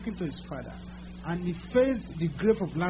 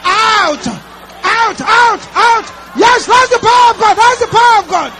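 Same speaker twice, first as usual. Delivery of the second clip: high, loud shouted deliverance prayer; fiery preaching; 13 semitones higher, 19 dB louder than usual. Real, not acted. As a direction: command the darkness out at full force. Deliverance prayer shouted at full force.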